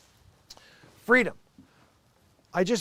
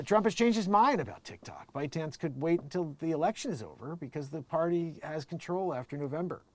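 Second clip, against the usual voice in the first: ordinary delivery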